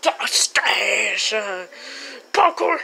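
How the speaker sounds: hushed, conspiratorial; secret whisper. Acted. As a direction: whisper like revealing treasure. Sneaking to secret food.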